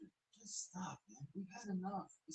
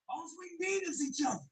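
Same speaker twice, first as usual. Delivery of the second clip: hype shout; 12 semitones higher, 11 dB louder than usual. Acted. Hype chant repeated.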